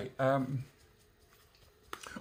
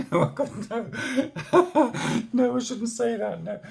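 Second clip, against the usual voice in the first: high giggling through the words; real laughter while taking it back; laughing; 9 semitones higher, 8 dB louder than usual. Real, not acted. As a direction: crack up at your own cheek. Laughing at own cheeky remark.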